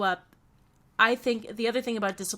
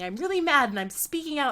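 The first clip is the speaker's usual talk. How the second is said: higher, emphatic retold declaration; imitating a mad child; 5 semitones higher than usual. Child telling his nanny how he feels, lightly imitated anger. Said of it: "those emotions that he's feeling".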